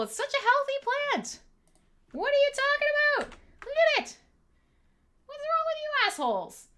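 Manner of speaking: high, loud indignant squeal defending the plant; mock outrage. Real, not acted. Defending her plant from chat, high indignant mock outrage. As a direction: shriek in playful outrage at the trolls.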